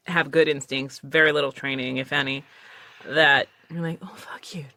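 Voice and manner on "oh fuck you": whispered mock curse; hushed, half-admiring swear; whispered envy. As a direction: curse them under your breath. Envious aside to naturally gifted actors.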